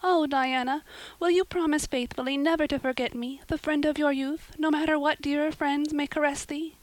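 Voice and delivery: high, earnest, theatrical pleading; tearful plea. A narrator's reading. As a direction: plead plaintively for a lasting promise. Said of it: "said Anne tearfully".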